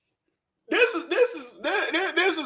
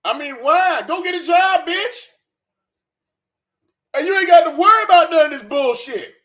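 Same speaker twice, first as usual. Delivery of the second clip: higher, louder yell; exasperated shout; 6 semitones higher, 6 dB louder than usual. Real, not acted. Ranting at the creator to get a job, shouting. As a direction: yell the obvious answer at her.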